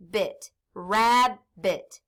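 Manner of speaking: drawn-out blend of rab and bit; slow blending. Shown as a demonstration. Teacher blending syllables for class, slowly. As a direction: stretch the word out as you blend it. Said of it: "So I can sound it out now".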